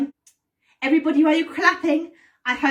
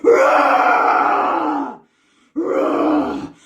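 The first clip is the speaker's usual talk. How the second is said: building shouts of roar, higher each time; shouted roar; 4 semitones higher, 5 dB louder, much slower than usual. Shown as a demonstration. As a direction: roar louder and louder. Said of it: "we're gonna shout roar five times".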